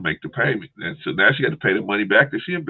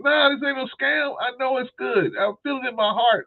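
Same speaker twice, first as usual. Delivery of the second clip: high-pitched mock voice of a stubborn sister; stubborn sister shrugging off a scam warning, high mock voice; imitating stubborn sister.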